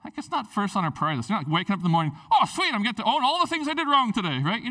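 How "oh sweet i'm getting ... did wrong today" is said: sarcastic sing-song, much higher and fast; mock cheerful. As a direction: toss it off as a sarcastic happy thought. Mock thought on waking up.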